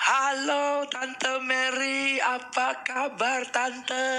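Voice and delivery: high, very slow, long stretched vowels; drawn-out friendly greeting. Shown as a demonstration. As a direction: pull every word long and sing it out. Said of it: "nadanya tarik panjang-panjang".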